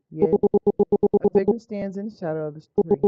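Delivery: ordinary delivery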